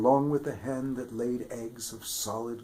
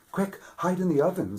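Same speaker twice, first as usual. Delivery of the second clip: fast, high, louder urgent hiss; urgent wife; 8 semitones higher, 7 dB louder, faster than usual. A narrator's reading. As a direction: hurry him into hiding. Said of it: "said the giant's wife".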